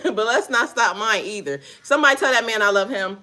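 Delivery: ordinary delivery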